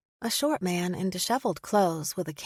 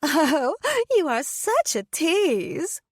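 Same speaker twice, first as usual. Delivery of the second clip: put-on high, slow, girlish giggly voice; giggly young girl; 10 semitones higher, 5 dB louder, much slower than usual. A narrator's reading. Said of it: "giggled like a young girl".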